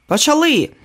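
high shouted call to start. Acted. Assistant calling the take, shouted. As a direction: bark the cue out high. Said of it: "Чийсь голос прокричав".

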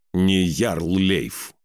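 slow barked warning; viking. A narrator's reading. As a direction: bark it slowly, a proud king. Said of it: "рявкнул Скаль". (медленно рявкнуть по-королевски)

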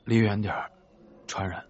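sick, hoarse voice fading at the end. Acted. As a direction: rasp it out like you have a fever. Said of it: "他嗓音沙哑地说".

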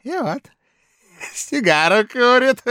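put-on loud, high drunken bluster; drunken blustering official. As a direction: boast it loud like a tipsy clerk. Drunk Kozulin gloats.

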